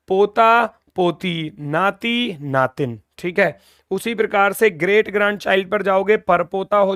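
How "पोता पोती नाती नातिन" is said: पोता पोती, नाती नातिन drawn out slowly; slow dictation. Shown as a demonstration. Teacher dictating the Hindi words to students, slowly. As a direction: spell it out word by word. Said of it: "ऐसा ही बोलोगे".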